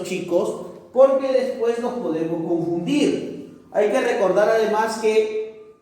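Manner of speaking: ordinary talk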